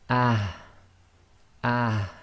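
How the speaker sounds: "ah" breathed softly from the bottom of the throat; a light "ah" from low in the throat; soft ha from deep throat. Shown as a demonstration. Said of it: "from the bottom of your throat".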